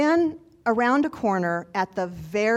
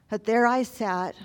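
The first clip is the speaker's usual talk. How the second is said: ordinary delivery, nothing marked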